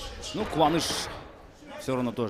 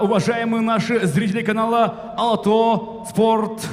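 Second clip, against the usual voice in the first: booming ring announcer intro; boxing ring announcer; 9 semitones higher, 10 dB louder than usual. Acted. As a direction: announce the fighter to the arena.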